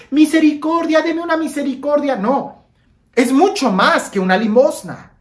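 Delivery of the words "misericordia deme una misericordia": higher, pleading beggar cry; mock beggar plea. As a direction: imitate a street beggar asking for change. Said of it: "beggar asking for alms".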